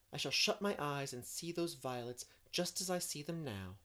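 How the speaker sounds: eager, dreamy Anne. Acted. Anne planning a birthday outing in the spring, eager and dreamy. Voices Anne cheering Jane.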